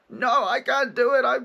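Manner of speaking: put-on high, loud, rushed scared child; vulnerable child voice. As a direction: plead like a frightened little kid. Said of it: "the vulnerable child".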